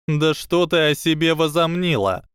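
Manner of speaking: high, unconvincing indignation; неудачное возмущение. Acted. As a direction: try to sound outraged and fail. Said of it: "негодование в голосе у Панси получилось не слишком хорошо". (неубедительное возмущение, голос повыше)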